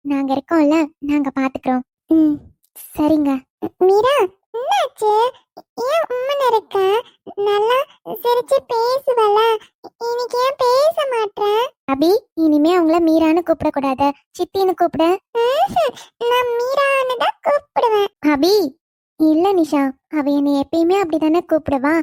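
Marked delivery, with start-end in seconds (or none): 3.9-11.4 put-on little child voice. Child Abi talking to Meera. 15.4-18.1 put-on high, slow little girl asking why she's sulking; little child voice. Child refuses to say chithi.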